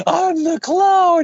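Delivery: high, slow, whiny cry; melodramatic wail. Retold or imitated. Mocking Ben's farewell to Peter, high drawn-out wail. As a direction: wail it like a tragic clone. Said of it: "And Ben's like".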